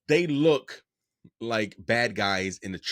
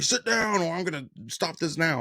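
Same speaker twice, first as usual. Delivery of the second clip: higher-pitched mock official's command; retold stern order; 6 semitones higher than usual. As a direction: imitate an organizer ordering the room to sit. Retold tournament chairman ordering everyone down.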